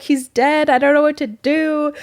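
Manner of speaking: high, panicky gasp of dread; retold panic. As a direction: relive the moment you thought he was dead. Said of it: "I'm freaking out 'cause I'm so high".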